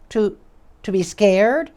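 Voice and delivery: high, hesitant timid voice; shy squeaky voice. Acted. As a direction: squeak it out shyly. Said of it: "asked Mister Bashfellow".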